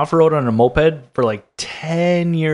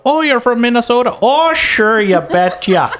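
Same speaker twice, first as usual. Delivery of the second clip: higher, exaggerated Minnesota sing-song; heavy Minnesota accent; 6 semitones higher than usual. Friend showing how she plays up her Minnesota accent for people. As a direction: pile on the Minnesota vowels, pitched up. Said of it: "throwing on the accent heavy on purpose".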